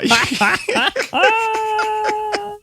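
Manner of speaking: high-pitched burst of laughter; real laughter, squealing up high; helpless high giggle. Real, not acted. Laughing at co-host's remark.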